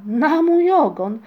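loud, high, drawn-out exclamation; astounded cry. A narrator's reading. Father sees Muminek flying at his window, crying out. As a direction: cry out slowly in amazement. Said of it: "zawołał".

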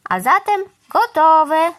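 slow, loud, high announcement; satisfied declaration, stretched out; declaring. A narrator's reading. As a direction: announce the job finished, drawn out. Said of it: "stwierdziła".

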